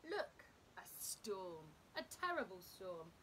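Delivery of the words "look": ordinary delivery, nothing marked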